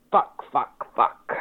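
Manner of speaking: pained cursing through the hurt; repeated curse from a stubbed toe. Real, not acted. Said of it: "I stubbed my toe".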